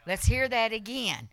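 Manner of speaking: normal talking voice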